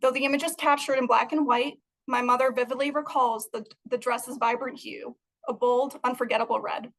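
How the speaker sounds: ordinary delivery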